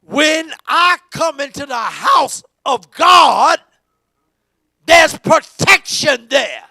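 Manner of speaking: slow, loud, shouted preaching; preaching at full force. Acted. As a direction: lean on every word, slow and loud.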